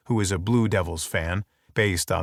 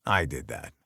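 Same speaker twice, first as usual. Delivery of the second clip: much lower, softer admission; guilty and low; 8 semitones lower, 7 dB quieter than usual. A narrator's reading. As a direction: quietly own the guilt. Guilt after seeing photo.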